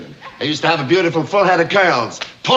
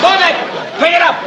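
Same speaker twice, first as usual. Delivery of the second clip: shouted orders; 12 semitones higher, faster than usual. Acted. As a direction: drive him like a drill sergeant. Boss barking orders at employee.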